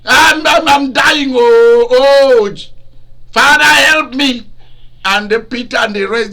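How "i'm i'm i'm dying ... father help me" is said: loud high moaning plea for help; dying man groaning for his father; Jesus crying out. Acted. Jesus on the cross begs his Father, loud dying moan. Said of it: "they saw him shouting and crying".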